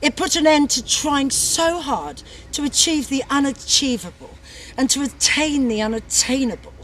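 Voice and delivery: usual speaking voice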